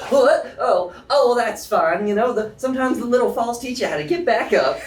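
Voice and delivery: high, loud cheerful lady brushing off a fall; cheerful and unbothered; bubbly Joy. Acted. Joy, bumped by a tail, laughing off the fall brightly. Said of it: "she just goes".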